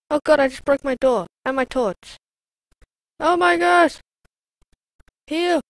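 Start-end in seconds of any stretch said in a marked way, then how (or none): none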